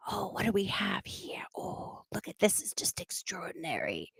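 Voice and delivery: soft, hushed Australian awe; awed whisper from a nature-show host. Retold or imitated. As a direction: whisper as if creeping up on a wild animal. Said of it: "It's like".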